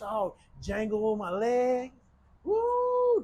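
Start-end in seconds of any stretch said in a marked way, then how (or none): 2.4-3.2 high delighted whoop; joyful whoop; excited woo. Real, not acted. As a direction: let out a happy woo at the breeze. Excited about breezy porch.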